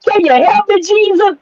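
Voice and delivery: high, whiny mock-despairing woman; hammed-up weepy movie heroine begging God; mocking Taraji P Henson character. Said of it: "watch Taraji P Henson sit there".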